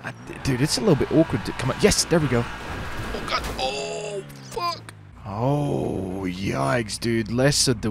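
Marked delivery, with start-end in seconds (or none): 2.5-4.9 much higher, softer startled oh fuck; shocked curse. Real, not acted. Gamer watching his bike wreck, startled curse. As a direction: caught off guard by the crash.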